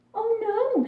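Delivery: high, startled cry of alarm; waking to find winter already here, panicking; panicked. A narrator's reading. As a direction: gasp it out in sudden fright. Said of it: "she panicked".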